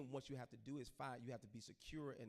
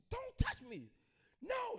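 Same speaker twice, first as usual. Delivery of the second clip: high-pitched frantic scream, much louder; mother screaming; 12 dB louder, slower than usual. Retold or imitated. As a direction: shriek it like a terrified prophet mother. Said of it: "she started crying and she started screaming".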